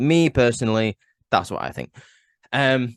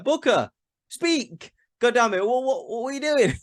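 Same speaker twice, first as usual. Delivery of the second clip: begging him to say anything, much higher; exasperated yell; 12 semitones higher than usual. Retold or imitated. At Booker T sitting silent on commentary, a high exasperated yell.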